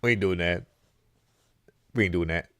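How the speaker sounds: low, soft mock politician's denial; retold denial. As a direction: play the president denying it, voice dropped low. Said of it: "he's like".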